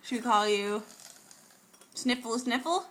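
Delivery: ordinary talk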